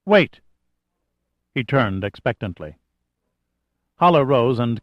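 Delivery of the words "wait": sharp, high, loud call; urgent call. A narrator's reading. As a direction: stop him before he leaves. Hala calls him back.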